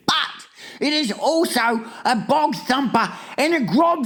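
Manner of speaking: high, emphatic character voice; exasperated giant. A narrator's reading. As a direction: insist dramatically on how dreadful it is.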